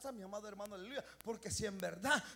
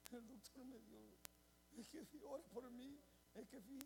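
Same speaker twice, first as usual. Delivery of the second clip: very soft, broken imitated sobbing speech; imitating a worried member, barely audible; tearful mumble; 14 dB quieter than usual. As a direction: mimic someone choked up.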